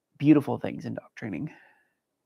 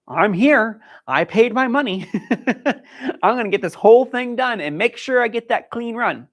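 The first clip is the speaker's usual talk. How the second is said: higher and louder, a pumped-up handler laughing at herself; eager mock self-talk; 7 semitones higher, 6 dB louder than usual. Handler mocking her own eagerness, higher and louder. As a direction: play the overeager competitor who paid her money. Handler's overeager mindset voiced.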